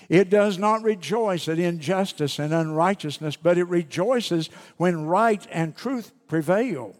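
measured, solemn Bible reading; scripture reading. Acted. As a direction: read the verses with weight. Reads scripture aloud.

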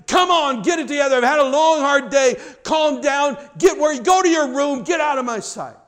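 loud, high, exasperated yell at the family; frustrated yelling. Retold or imitated. Tired parent yelling at spouse and kids, loud and fed up. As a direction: snap at the whole house after a long day. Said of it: "you would just yell at your spouse and yell at your kids".

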